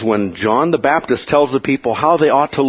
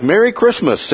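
mock friendly greeting; 9 semitones higher than usual. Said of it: "spoke with friendliness and lightheartedness".

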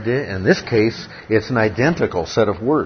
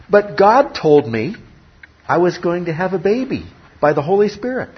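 higher voice protesting her story; mock Mary; 8 semitones higher, 4 dB louder than usual. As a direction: play Mary insisting to doubters.

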